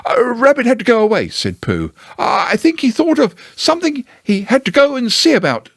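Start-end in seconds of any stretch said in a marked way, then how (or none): 0.0-1.3 high, quick, hesitant bear voice; Pooh's voice. Acted. Pooh voiced in story. 2.2-5.7 high, quick, hesitant bear voice; Pooh's voice. Acted. Pooh voiced in story.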